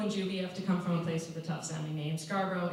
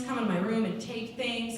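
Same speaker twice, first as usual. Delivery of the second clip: ordinary delivery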